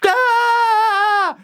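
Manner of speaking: high, drawn-out imitated scream; mock scream at high pitch; child's scream. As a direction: imitate a kid's long, high shriek. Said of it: "Sadie screaming".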